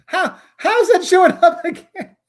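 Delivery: high, loud, laughing bafflement; amused disbelief. Real, not acted. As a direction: laugh through the question, slow and high. Amused by something popping up again.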